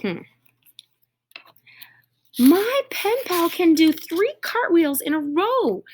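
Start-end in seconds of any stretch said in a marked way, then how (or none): 2.4-5.8 shouting. Acted. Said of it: "shouted Kyla".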